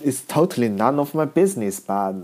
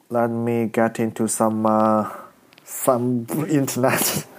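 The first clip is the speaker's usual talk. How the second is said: ordinary talk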